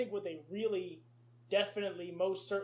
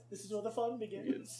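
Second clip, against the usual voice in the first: Anakin line; 5 dB quieter than usual. Retold or imitated. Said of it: "if you're on the space battles".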